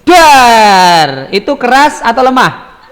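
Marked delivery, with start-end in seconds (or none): 0.0-1.3 loud thunder "dar", drawn out and falling; vocal thunder crash; thunderclap imitation. Shown as a demonstration. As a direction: boom it out like thunder. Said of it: "Ustadz punya contoh suara petir".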